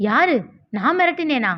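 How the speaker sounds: high, startled questioning; incredulous. A narrator's reading. Young man accused of threatening a schoolgirl, incredulous.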